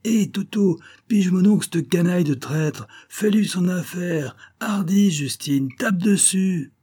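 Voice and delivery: high, raucous yelling from up in the balcony; a street kid hollering at the stage, egging the hero on; heckler shouting from the gallery. A narrator's reading. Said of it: "un titi criât d'en haut".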